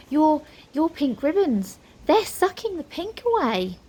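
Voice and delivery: high, horrified stammer rising; gasping alarm. A narrator's reading. Nine sees the ribbons fading, alarmed. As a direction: point it out in growing alarm. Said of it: "Nine gasped and pointed".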